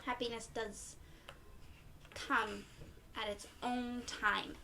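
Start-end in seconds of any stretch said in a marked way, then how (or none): none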